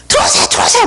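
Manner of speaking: high-pitched excited yell, re-enacted; retold shouting. Host to arriving visitors over blaring music, shouting. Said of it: "막 소리 지르면서".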